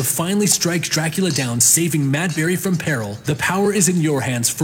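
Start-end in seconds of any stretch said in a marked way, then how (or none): none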